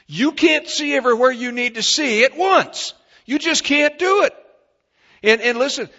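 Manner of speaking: high, loud rising preacher's cry; preaching at full force. Acted. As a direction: drive it home to the congregation. Pastor driving home blind spots.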